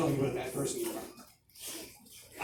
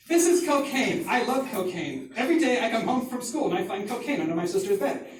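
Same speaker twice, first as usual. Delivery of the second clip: put-on higher, louder proud kid declaration; proud announcement; 5 semitones higher, 4 dB louder than usual. Child showing everyone at stand-up time, proud announcement. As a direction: announce it brightly to the whole room.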